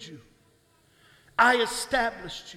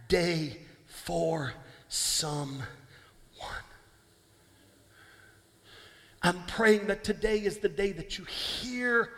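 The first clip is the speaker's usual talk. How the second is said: prayer; 2 semitones lower, slower than usual. Acted. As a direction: pray it gently over the room. Prayerful intercession.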